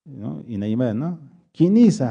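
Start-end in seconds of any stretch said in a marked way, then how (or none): 1.5-2.0 "¿Quién es?", dicho más fuerte y burlón. Retold or imitated. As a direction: imita la pregunta con burla. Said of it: "Como burlándose". (imitando con tono burlón)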